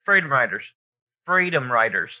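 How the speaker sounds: ordinary talk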